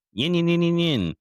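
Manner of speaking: high, loud engine whine yin yin yin; revving engine imitation. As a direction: mimic a screaming tire burnout.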